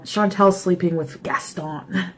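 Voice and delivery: normal talking voice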